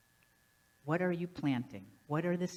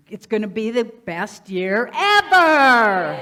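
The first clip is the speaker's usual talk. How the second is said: huge drawn-out shout; rallying shout; 4 semitones higher, 15 dB louder, slower than usual. Acted. Speaker rousing the audience for the best year, rallying shout. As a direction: yell it slowly, stretching the last word out.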